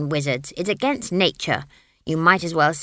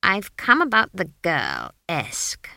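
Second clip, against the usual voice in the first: hissed; 4 dB louder, slower than usual. A narrator's reading. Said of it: "she hissed".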